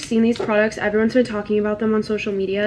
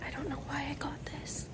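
barely audible whisper to the camera; whispered aside; 4 semitones higher, 19 dB quieter than usual. Real, not acted. Vlogger to viewers out in public on campus, whispered. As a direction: whisper it almost without voice.

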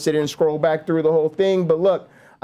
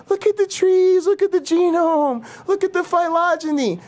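high, sing-song mocking biologist; 11 semitones higher than usual. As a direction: lilt each "look at" like a smug lecturer. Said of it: "That's what they do".

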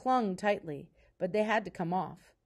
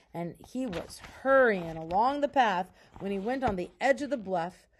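ordinary delivery

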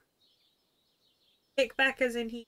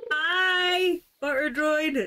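excited greeting; 6 semitones higher, 4 dB louder, much slower than usual. Real, not acted. Greeting a game character excitedly.

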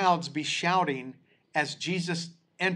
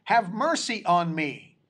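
higher, more desperate plea; crying out louder; 5 semitones higher than usual. Acted. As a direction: cry it out all the more over the crowd. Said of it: "he cried out all the more".

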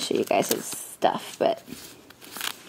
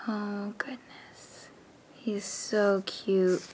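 slow, soft whisper; tender whisper; 9 dB quieter, slower than usual. Real, not acted. Cooing over her new doll, slow tender whisper.

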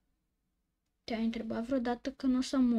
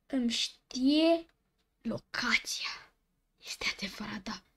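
creeped-out hush; scared whisper. Real, not acted. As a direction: whisper like someone is listening. Game knows his location.

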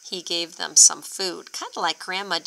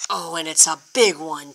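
usual speaking voice

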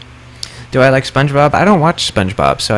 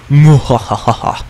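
hammed-up villain cackle, muhahaha; evil laugh; 2 semitones higher than usual. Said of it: "The evil laugh of laughs".

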